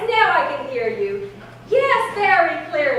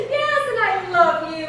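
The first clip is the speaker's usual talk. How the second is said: ordinary talk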